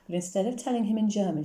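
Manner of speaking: ordinary delivery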